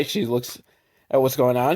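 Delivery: ordinary talk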